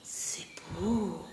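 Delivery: very soft, low whisper; awed whisper. Real, not acted. Woman admiring her decorated shelf, awed whisper. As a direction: breathe it out in hushed admiration.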